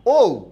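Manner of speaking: teacher models the vowel ঔ; demo vowel ou. Shown as a demonstration. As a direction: sound ঔ out clearly for the class. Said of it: "দুটা স্বরধ্বনি কি একই কি না?".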